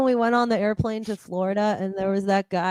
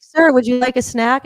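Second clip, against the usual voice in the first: slow, higher, louder put-on polite voice; stewardess; 4 semitones higher, 6 dB louder than usual. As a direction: offer the snack slowly and brightly like a stewardess. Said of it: "do it again".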